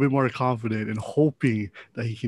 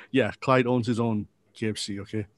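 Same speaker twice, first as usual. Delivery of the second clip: ordinary talk, nothing marked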